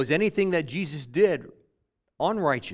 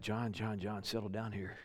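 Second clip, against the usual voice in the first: much softer, lower settling voice; quiet calming; 4 semitones lower, 13 dB quieter than usual. Retold or imitated. Jesus calming a protesting John, quiet and low. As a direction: talk him down gently and quietly.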